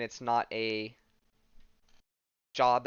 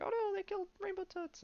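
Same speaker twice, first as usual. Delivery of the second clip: squeaky cartoon critters, much higher; tiny pet voice; 5 dB quieter than usual. Little pets wailing over Rainbow Toots in squeaky voices. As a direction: pip it out like tiny panicked pets.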